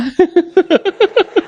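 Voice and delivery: high-pitched giggling; amused laugh. Real, not acted. Speaker laughing at the note joke, high giggle.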